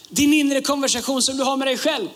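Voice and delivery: normal talking voice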